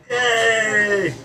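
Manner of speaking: high, loud, sustained yay; loud cheer. Acted. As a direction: let out a big high cheer. Cheering after laughing.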